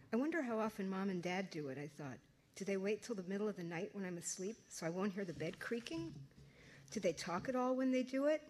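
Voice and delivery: reading her novel aloud to an audience; story narration. A narrator's reading. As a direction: tell it like a teenage diary.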